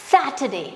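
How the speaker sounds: teacher's model SAT-er-day; correct Saturday. Shown as a demonstration. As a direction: model सैटरडे softly. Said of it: "क्या बोलेंगे आप".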